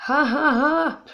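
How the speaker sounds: loud high laugh; loud burst of laughter. Real, not acted. She laughs at the name while playing, a loud laugh. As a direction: let out a big real laugh.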